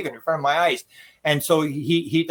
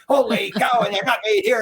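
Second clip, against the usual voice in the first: mock amazement, higher pitch; imitating excited onlookers; 5 semitones higher than usual. Mimicking everybody reacting to the metal pieces, excited. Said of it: "everybody's all excited".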